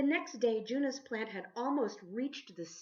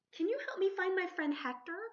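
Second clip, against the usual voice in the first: put-on high, bright little-girl ask; Juna's voice; 11 semitones higher, 5 dB louder, faster than usual. Girl asking the sea creatures for help, high and eager. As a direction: pitch up high and a bit louder as young Juna. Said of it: "Juna asked".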